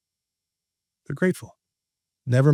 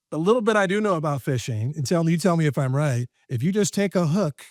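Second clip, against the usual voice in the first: normal talking voice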